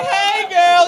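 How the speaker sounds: loud, high catcall; hammed-up pick-up line; flirty call-out. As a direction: holler a hello across the room. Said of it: "If you were to be like".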